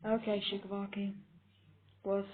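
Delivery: unimpressed, muted jab at a coward; dry dismissal. Acted. Henchman answering his panicking boss, dryly dismissive.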